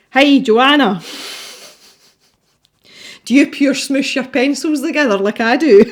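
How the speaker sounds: loud, high, hammed-up Glaswegian; broad Glaswegian accent. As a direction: call it out in playful broad Glaswegian. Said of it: "in a broad Glaswegian accent".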